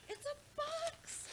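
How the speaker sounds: high, slow fake astonishment; mock surprise. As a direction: play it up like a shocked kid. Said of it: "Just kidding".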